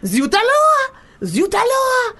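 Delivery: high, loud French exclamation; hammed-up French. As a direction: squeal it like an overacted French lover. Continuing French fake moan bit.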